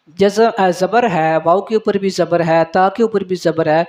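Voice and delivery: ordinary talk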